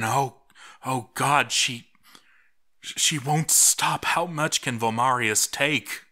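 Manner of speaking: ordinary delivery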